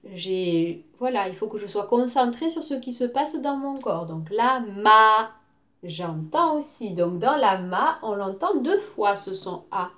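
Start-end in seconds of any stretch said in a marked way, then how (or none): 4.3-5.3 "la ma" slow, ending on a loud long A; drawn-out A sound. Shown as a demonstration. Teacher shows the A to pupils, drawing out "ma". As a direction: stretch the A in "ma". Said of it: "ma bouche s'étire".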